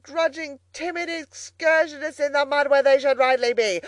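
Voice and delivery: loud, high boasting as Toad; ecstatic self-admiration. A narrator's reading. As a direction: crow triumphantly, praising yourself to the skies. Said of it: "he cried in ecstasies of self-admiration".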